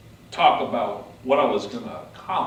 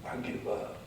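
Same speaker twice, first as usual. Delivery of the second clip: hopeless surrender; 6 dB quieter than usual. Retold or imitated. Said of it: "I said".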